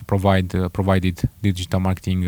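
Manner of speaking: usual speaking voice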